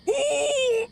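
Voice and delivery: very high delighted ooh; surprised. Real, not acted. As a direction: squeal at the discovery. Discovering new board.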